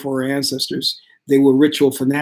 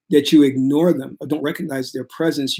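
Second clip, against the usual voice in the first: normal talking voice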